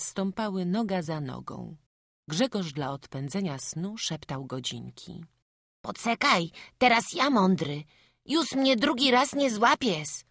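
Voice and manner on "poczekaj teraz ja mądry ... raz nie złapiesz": put-on high, loud child's defiance; cocky kid's high-pitched threat; threatening little boy. A narrator's reading. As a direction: threaten an absent tyrant as a small boy. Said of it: "grożąc ściśniętą w kułak rączką".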